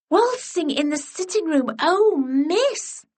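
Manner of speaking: put-on high, scandalised girlish squeal; thrilled gossiping pupil; delighted schoolgirl. A narrator's reading. Melisande hearing of the waltz, high and thrilled.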